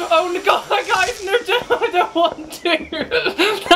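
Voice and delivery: high frightened pleading, slowed at the end; frightened squeal. Real, not acted. Scared player at the monster, high frightened squeal. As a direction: squeal no over and over. Startled by game.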